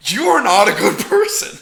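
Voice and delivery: high, loud, amused indignation; mock outrage. Viewer reacting to his feeble excuse, mock outrage.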